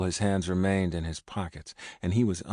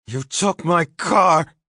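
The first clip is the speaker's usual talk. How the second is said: high, loud, slow shouted accusation; furious yell; 11 semitones higher, 11 dB louder, slower than usual. A narrator's reading. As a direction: scream it in his face. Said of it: "I yelled, right in his face".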